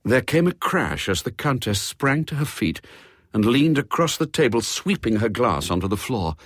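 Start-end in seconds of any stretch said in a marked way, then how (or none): none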